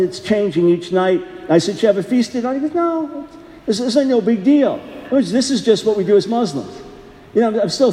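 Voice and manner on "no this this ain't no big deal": usual speaking voice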